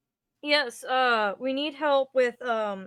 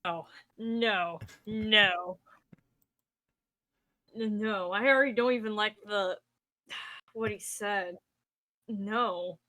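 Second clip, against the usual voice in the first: low, quieter groaning no; dismayed no; 3 semitones lower, 4 dB quieter, slower than usual. Real, not acted. Player recoiling from the goblin image, groaning. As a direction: recoil in real dislike.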